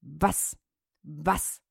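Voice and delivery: soft low barked Was; dog bark. Acted. The dog answering with a low soft bark. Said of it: "sprach der Hund".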